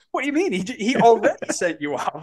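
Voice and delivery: very high mock-baffled question; incredulous retort. Official answering the protesting coach, mock incredulous.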